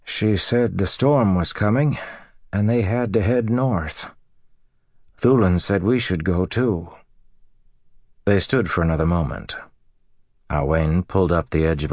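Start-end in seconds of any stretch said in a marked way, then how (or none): 0.0-6.9 lightly voiced farmer, raised pitch; dazed husband relaying news. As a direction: pass on a puzzling message to your wife. Voices husband relaying storm warning.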